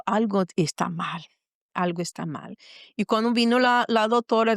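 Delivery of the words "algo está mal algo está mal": low, sinking realisation; understated foreboding, voice pitched down; retold dread. Retold dread before the doctor's news.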